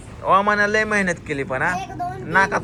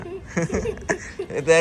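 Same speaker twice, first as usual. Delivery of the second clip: bright giggling burst; real laughter; high burst of laughter; 11 semitones higher than usual. Real, not acted. Kids racing at pani puri.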